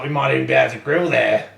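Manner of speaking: lightly hammed-up Australian accent; Hillary. Imitating the butcher talking about grilling, light Australian accent. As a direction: carry on the mock Aussie butcher. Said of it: "let me do my Hillary".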